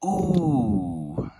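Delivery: loud, high drawn-out ooh; delighted "ooh". Real, not acted. Card opener seeing a dark type pull, delighted ooh. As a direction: let out a big impressed "ooh".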